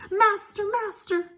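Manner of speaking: very high, loud cry for help; calling out in alarm. Acted. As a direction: shriek for the master to wake. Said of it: "the harp called out".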